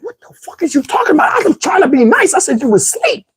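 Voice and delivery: very high-pitched mock outrage; indignant comeback. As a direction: protest in a high, incredulous squeal. Retold indignant comeback in phone quarrel.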